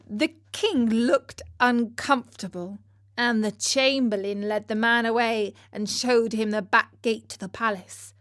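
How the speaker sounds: usual speaking voice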